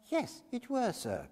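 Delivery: eager humble witness voice; high fussy old woman witness. A narrator's reading. Mrs Cluppins answers.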